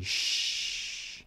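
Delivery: soft whispered shh; library shush. Shown as a demonstration. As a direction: hush like a librarian. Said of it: "The sound is a library".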